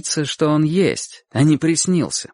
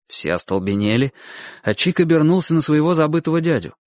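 usual speaking voice

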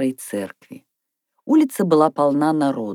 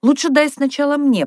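high, loud little plea; child's pushy request; pleading request; 10 semitones higher, 5 dB louder than usual. A narrator's reading. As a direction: ask eagerly, pitched well up. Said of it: "попросила Катя".

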